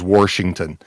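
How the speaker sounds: Washington with an added r, Warshington; regional pronunciation. Retold or imitated. Host relays how a viewer's mom says it, regional pronunciation. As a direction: say "Washington" as "Worshington". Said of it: "her mom also says Washington".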